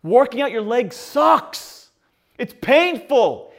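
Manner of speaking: slow, high, loud drawn-out whine; hammed-up lazy groan about leg pain; mock whining. Complaining to his roommate about leg day: slow whine.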